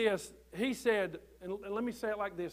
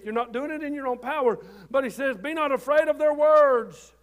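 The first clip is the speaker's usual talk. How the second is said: full-force preaching; 4 semitones higher, 10 dB louder than usual. Acted. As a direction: drive the promise home to the congregation. Preacher building to climax.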